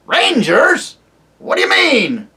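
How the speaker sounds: put-on high, loud querulous old dwarf; deaf old dwarf. Deaf dwarf to the owl, loud querulous old man. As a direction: bark it like an old man hard of hearing.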